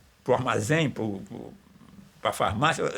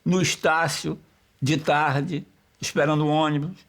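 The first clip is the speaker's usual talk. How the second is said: higher, louder poetic recital of the opening lines; reciting his poem; 4 semitones higher, 4 dB louder than usual. Shown as a demonstration. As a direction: declaim it like the start of a poem. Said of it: "aí diz assim". (recitando o poema, mais agudo e mais alto)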